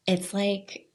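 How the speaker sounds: normal talking voice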